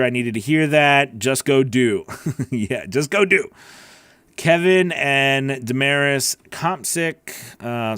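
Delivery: ordinary talk, nothing marked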